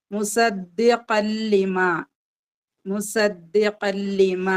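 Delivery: slow recitation of മുസദ്ദിഖൻ ലിമ, nun merged into lam; recited with idgham. Shown as a demonstration. Teacher showing students the merged reading, slow and chanted. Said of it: "നമ്മൾ പാരായണം ചെയ്യുന്ന സമയത്ത്".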